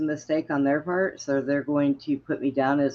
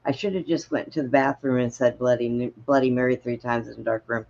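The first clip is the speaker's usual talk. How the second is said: ordinary talk, nothing marked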